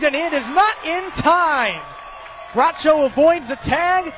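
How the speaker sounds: rising to a shout as the runner slides home; excited play call. Real, not acted. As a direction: build the call to a shout at the plate.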